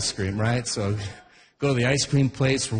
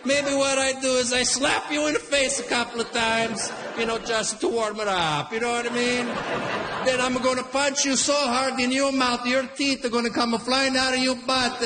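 put-on higher Italian accent, threatening dad; mock Italian threats, pitched up; 5 semitones higher than usual. Italian dad threatening the line-cutter in an Italian accent. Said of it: "My Italian dad right away".

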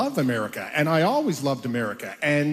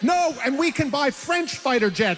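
higher, eager sales pitch; lightly hammed-up pitching jets; arguing into his head; 8 semitones higher than usual. Pushing Swedish jets to the caller, higher and eager. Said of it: "And I'm, like, saying".

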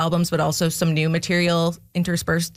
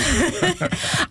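short burst of high laughter; laughing; 10 semitones higher than usual. Real, not acted. Amused at the hour-long short film.

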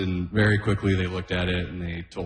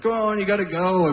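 a hint of a nagging dad, higher; lightly voiced dad hurrying his son; parent nagging; 11 semitones higher than usual. Joseph urging young Jesus to go give a sermon, lightly nagging. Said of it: "Joseph would be like".

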